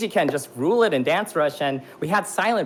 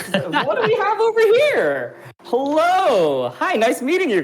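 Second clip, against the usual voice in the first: playful excitement; 8 semitones higher, slower than usual. Real, not acted. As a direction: greet them with giddy energy. Playful greeting, high voice.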